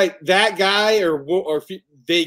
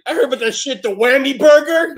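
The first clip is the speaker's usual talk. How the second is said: higher, louder eager voice; excited movie character; 11 semitones higher, 9 dB louder than usual. Retold or imitated. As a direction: quote him all pumped up. Acting the excited film character.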